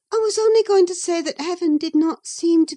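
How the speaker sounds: put-on a young lady answering back, pitched high; Catherine. Catherine's voice in the reading.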